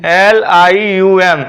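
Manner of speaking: एल, आई, यू, एम spelled very slowly; drawn-out letters of एल आई यू एम for learners. Shown as a demonstration. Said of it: "मैं बोला था ना". (हर अक्षर खींचकर, धीरे)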